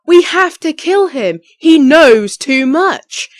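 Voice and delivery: high, loud and slow villain line; a villainess plotting a murder, loud and high; villainous scheming voice. Shown as a demonstration. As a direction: menace it out like a spoiled villainess. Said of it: "Kind of like that".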